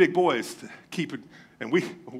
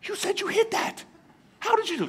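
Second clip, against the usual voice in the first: put-on much higher, boyish voice, baffled; kid brothers in disbelief; 13 semitones higher, 3 dB quieter than usual. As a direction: play the stunned little brother asking how. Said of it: "We looked at each other like".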